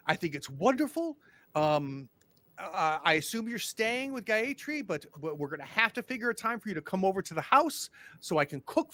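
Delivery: nervous welcoming mayor, overeager; eager small-town mayor. Acted. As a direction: fluster and gush over the visitors. GM holds mayor George voice.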